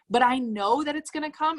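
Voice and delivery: usual speaking voice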